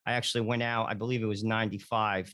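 normal talking voice

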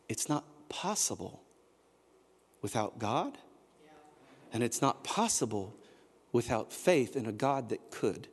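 dropped low and soft, almost confiding; hushed conviction. Real, not acted. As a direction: lean in and say it softly. Quiet emphatic aside in sermon.

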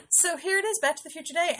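bright, high, excited announcement. Real, not acted. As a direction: announce it with a rising thrill. Celebrating Back to the Future Day.

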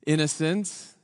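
usual speaking voice

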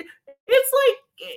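usual speaking voice

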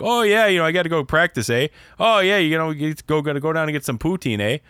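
hammed-up Canadian hockey player accent. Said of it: "starts sounding like they're from Canada".

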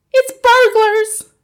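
very high, loud, drawn-out alarm; a mother's urgent, frightened warning; panicked hiss. A narrator's reading. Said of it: "hissed the mother".

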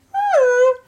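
high, loud imitated animal cry; animal call. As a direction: let out a high trumpeting call.

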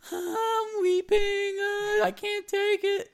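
high, drawn-out fake wail imitating fangirls; mock weeping. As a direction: whine it slowly like a melodramatic sobbing fan. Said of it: "girls are commenting".

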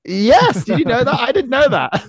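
high, loud surprised delight; thrilled at a fact they never knew; excited surprise. Real, not acted.

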